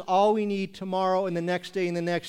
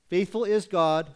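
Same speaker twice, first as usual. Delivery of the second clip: ordinary talk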